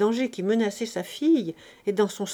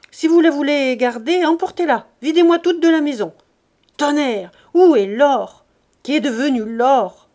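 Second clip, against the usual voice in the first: shouting, much louder and higher; furious miser; 5 semitones higher, 10 dB louder than usual. Acted. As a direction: roar at the wife over the lost gold. Grandet rages about the gold. (colère furieuse, crié)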